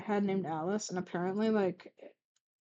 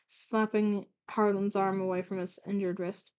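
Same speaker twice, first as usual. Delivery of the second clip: plain reading voice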